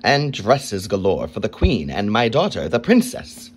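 put-on king ordering the boy to make a cloak. A narrator's reading. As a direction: command it like a greedy king. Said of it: "he ordered".

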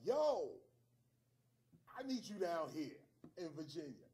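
loud friendly shout; loud call. Retold or imitated. Kelton calling him up, loud greeting. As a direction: call out like on the phone.

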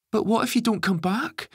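put-on upset child, very high and loud; clearly upset. A narrator's reading. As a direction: plead high and loud like a distraught boy. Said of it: "clearly upset".

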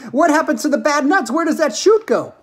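high, loud panicked questions; shrieked. Acted. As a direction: fire off questions as a shrieking mother. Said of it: "shrieked Missus Salt".

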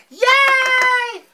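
high squealed yay; a big high-pitched cheer for the show. Acted. Host cheering her own lineup, high squealed yay.

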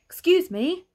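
high, loud polite call; strange neighbour's voice. A narrator's reading. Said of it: "said the strange voice".

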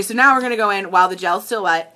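ordinary talk, nothing marked

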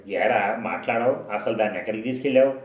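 ordinary delivery, nothing marked

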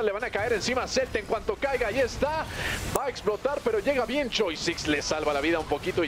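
fast, rising esports call; excited play-by-play. Real, not acted. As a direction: call the fight as it breaks out. Team fight call, pitch way up. (narración deportiva emocionada)

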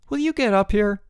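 high, anxious demand; shaky voice. A narrator's reading. Boots wanting Bruno up the tree, shaky. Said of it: "a shaky voice replied".

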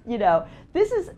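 ordinary delivery

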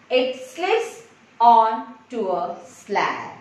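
slow classroom reading with spaced-out words; slow model reading. Shown as a demonstration. Teacher reading a blend sentence to kids, slow and deliberate. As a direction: read it out word by word, slowly.

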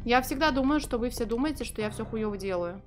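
ordinary delivery

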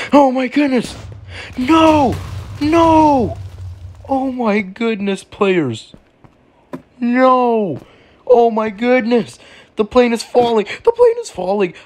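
very high, drawn-out cries; hammed-up panic. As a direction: wail in mock terror as the plane drops. Plane starts to fall.

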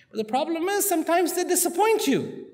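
voicing someone grumbling that things disappoint; mock whiny complaint. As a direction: complain in a put-upon, high voice.

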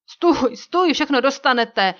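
high, loud cry to stop; panicked shout. A narrator's reading. Chief grabbed by the hair with a knife drawn, crying out. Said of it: "Proto náčelník vykřikl".

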